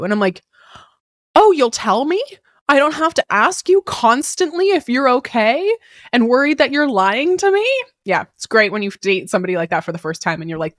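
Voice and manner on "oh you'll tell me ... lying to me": higher, louder, delighted disbelief; amazed disbelief. Retold or imitated. To a new partner, in higher, louder amazed disbelief. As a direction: marvel at it like a revelation. Her own amazed reaction.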